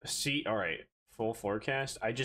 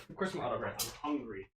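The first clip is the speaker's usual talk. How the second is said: usual speaking voice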